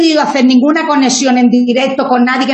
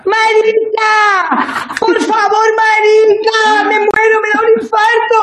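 ecstatic shrieking; 6 semitones higher, slower than usual. Real, not acted. Ecstatic greeting on the video call.

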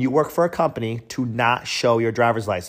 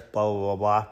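ordinary delivery

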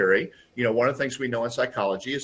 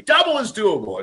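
higher, louder proclamation; announcing the slogan; 8 semitones higher, 5 dB louder than usual. Retold or imitated. Father declaring the CEO's slogan at home, raised voice. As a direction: boom the slogan to the family. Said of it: "went home to the family".

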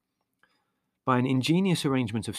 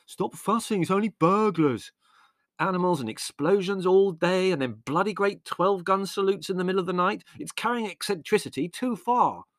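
exasperated shouting; 9 semitones higher than usual. A narrator's reading. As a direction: shout it, fed up with the family. Brothers shouting in the chaos.

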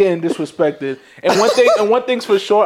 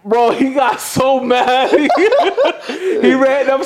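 laughing; 7 semitones higher, slower than usual. Real, not acted. Laughing while telling the story.

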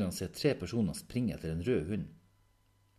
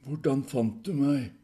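higher, loud, slow demand; demanding question; 7 semitones higher, 9 dB louder, slower than usual. Acted. Proval confronting the visitor, loud demand. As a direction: demand it slowly and forcefully.

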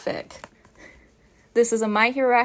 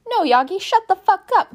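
higher, louder snapped order; angry shout; 9 semitones higher, 5 dB louder than usual. Acted. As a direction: cut him off furiously. Shota berates Yagi.

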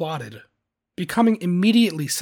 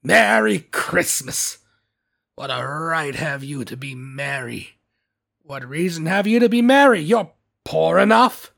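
scornful Scrooge; 4 dB louder than usual. A narrator's reading. Scrooge scorns nephew's greeting.

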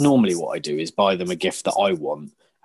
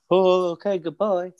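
put-on flustered kid; 8 semitones higher than usual.

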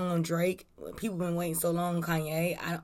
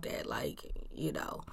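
whispering; 4 semitones lower, 12 dB quieter than usual. Real, not acted. Aside under her breath.

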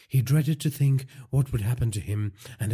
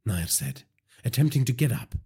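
ordinary delivery, nothing marked

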